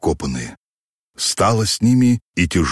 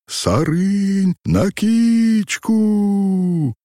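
high, long-held ringing call; drawn-out war cry; 13 semitones higher, much slower than usual. A narrator's reading. Robbers' cry carried through the forest close by, long and ringing. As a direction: holler it slowly across the woods. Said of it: "совсем близко пронеслось по лесу".

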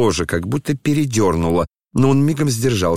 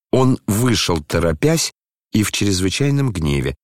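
plain reading voice

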